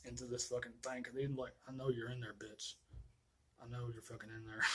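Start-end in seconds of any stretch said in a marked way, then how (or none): 1.7-4.6 quiet menace. Retold or imitated. Voicing Myers stalking the victim.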